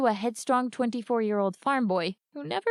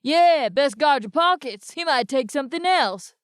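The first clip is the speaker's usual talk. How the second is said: called out mockingly; 5 semitones higher, 6 dB louder than usual. A narrator's reading. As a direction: jeer from the crowd. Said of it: "called another".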